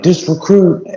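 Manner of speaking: high, loud recruit yell; barked sir yes sir. Retold or imitated. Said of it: "I'm like".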